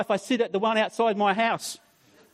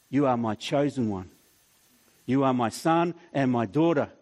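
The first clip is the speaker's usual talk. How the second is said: lower, gentle affirmation; reassuring God; 6 semitones lower than usual. Pretend. God speaks to the listener.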